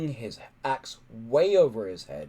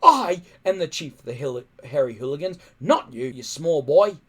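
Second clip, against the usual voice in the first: loud, angry chief's rebuke; 8 dB louder than usual. Acted. Stoic angrily putting his small son in his place, loud. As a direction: put the boy in his place.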